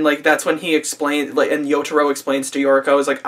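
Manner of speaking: ordinary talk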